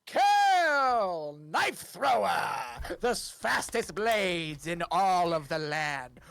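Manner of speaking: stretched-out showman's announcement; grand, slow ringmaster patter; circus ringleader. Acted. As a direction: draw out every big word for the crowd. Ringleader announcing the first act.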